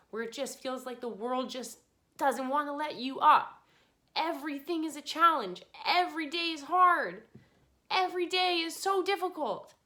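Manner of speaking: high, loud tearful plea; crying, emotional. Real, not acted. Urging struggling listeners that she knows every day is hard, tearful. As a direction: voice climbing as the tears come. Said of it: "I'm crying 'cause I'm emotional".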